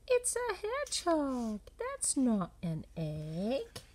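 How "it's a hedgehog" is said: usual speaking voice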